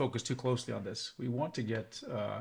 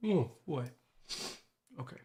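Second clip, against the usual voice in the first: ordinary delivery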